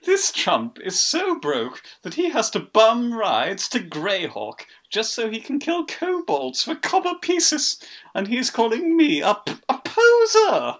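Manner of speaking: high sneering showoff; posh bastard voice. Acted. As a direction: play to the crowd, snobbish. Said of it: "we picked the right voice".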